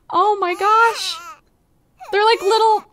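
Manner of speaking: high, loud gushing over something cute. Real, not acted. Cooing over the baby.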